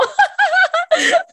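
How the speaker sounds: a high, loud laugh bursting out; high burst of laughter. Real, not acted. Laughing at her own past self on the podcast, a high loud laugh. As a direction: crack up at your own cluelessness.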